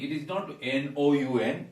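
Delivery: ordinary delivery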